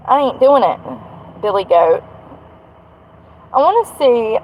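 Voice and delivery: ordinary delivery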